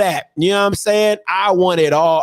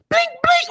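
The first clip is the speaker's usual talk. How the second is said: scanner beep imitation. Mimicking registry scanner beeps.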